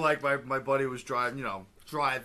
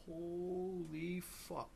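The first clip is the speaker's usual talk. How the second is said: drawn-out, hushed, higher-pitched exclamation; mock disbelief; 4 semitones higher, 13 dB quieter, much slower than usual. Reacting to kids' meltdowns, mock hushed disbelief. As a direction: stretch it out slowly under your breath.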